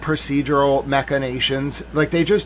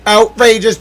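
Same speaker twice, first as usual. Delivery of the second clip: mock outrage; 6 semitones higher, 8 dB louder than usual. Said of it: "I'm mad".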